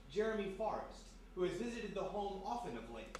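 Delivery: ordinary delivery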